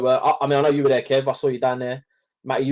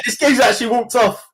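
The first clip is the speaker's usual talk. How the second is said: higher, laughing through it; 9 semitones higher, 5 dB louder than usual. Real, not acted. Telling the boys, laughing at Brent's prank. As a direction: giggle out the ending.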